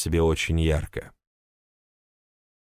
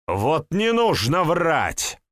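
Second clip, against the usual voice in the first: sharp, raised, slow bark; 10 semitones higher, slower than usual. Acted. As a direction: snap it out angrily. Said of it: "рявкнул Андрей, резко повысив голос".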